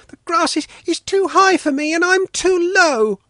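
put-on very high little-girl grumble; complaining. A narrator's reading. Svetlana struggling in tall grass, high complaining child. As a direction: whine like a small child, pitched right up. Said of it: "Svetlana complained".

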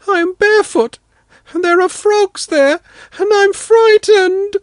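put-on high, slowing child's voice, scared; a barefoot girl afraid of frogs; frightened little girl. Daughter tells father she is scared, high child voice. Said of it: "I felt very sorry then for Svetlana".

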